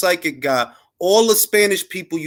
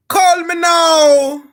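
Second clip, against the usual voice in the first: put-on high, slow, loud Caribbean-accented call; 14 semitones higher, 7 dB louder, much slower than usual. Caribbean accent, psychic hotline ad call. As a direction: belt it like a late-night psychic ad in a Caribbean accent. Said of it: "cross of [name] and [name]".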